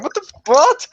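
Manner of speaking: high, loud baffled squeak; shocked what-the cry; stunned disbelief. Retold or imitated. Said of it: "And I'm like".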